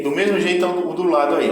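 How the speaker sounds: normal talking voice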